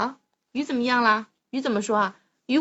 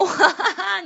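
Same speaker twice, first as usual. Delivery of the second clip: pirate laugh; 7 semitones higher, 5 dB louder than usual. Pretend. Parent clowning as a pirate.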